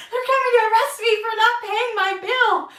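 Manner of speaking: high, loud mock-panic; loud alarmed rush of words; panicked. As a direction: act out being sure you'll be arrested. Panic thinking arrest.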